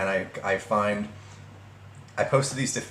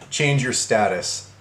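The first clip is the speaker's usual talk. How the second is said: slow, drawn-out mock command; mocking emphasis; slower than usual. Rereading the ex's message for viewers, slowly mocking. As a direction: relish each word of the quoted order. Repeats the part he likes.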